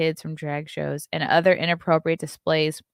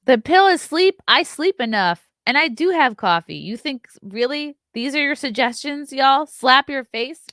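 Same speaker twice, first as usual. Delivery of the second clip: incredulous; 8 semitones higher, 6 dB louder than usual. Real, not acted. Scoffing at viewers' suggestions.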